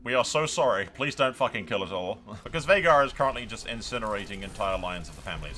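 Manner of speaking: ordinary talk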